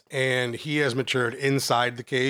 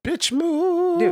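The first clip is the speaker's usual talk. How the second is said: high, drawn-out heckle; high-pitched jeer; mocking call-out. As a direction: call it out high, stretching the last word. Heckles, stretching the word out.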